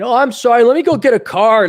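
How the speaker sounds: hammed-up naive sympathiser; gullible nice guy. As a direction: fuss over them with mock concern. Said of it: "if you were younger or stupider".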